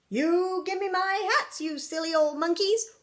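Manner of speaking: loud, high, hammed-up demand; storybook outrage; mock angry scolding. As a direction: tell off the cheeky monkeys at the top of a playful voice. Son scolds the monkeys.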